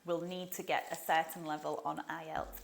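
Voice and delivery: ordinary talk, nothing marked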